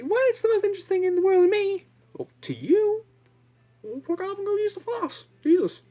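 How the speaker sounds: very high, loud mock-excited kid voice; floss-loving kid squealing high with fake glee; squeaky mocking kid. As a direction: squeal it like an overjoyed kid who got floss. Kid's retort in squeaky voice.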